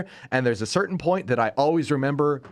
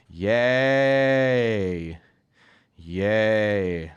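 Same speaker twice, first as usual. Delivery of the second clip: sarcastic yay; 3 semitones lower than usual. Real, not acted. Sarcastic cheer about Chris Pratt.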